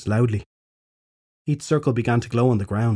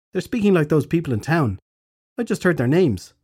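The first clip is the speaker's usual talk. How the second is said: queen voice; 5 semitones higher than usual. A narrator's reading.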